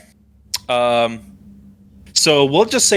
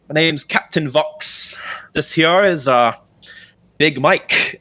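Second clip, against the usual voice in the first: higher-pitched, easygoing adventurer captain; friendly captain; 4 semitones higher than usual. Acted. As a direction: introduce yourself and your crew in a captain's character voice. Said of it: "Seems friendly enough, and he's just kinda like".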